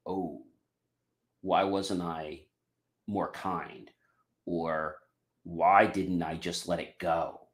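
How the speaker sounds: soft, hushed self-reproach; regretful inner voice. Retold or imitated. Person realising they were unkind, quiet regret. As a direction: murmur it like a sudden regret.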